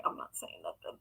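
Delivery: very soft, low aside; barely voiced, retreating mumble; hushed backing down. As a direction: drop to a tiny near-whisper to yourself. Quotes her own decision to keep quiet.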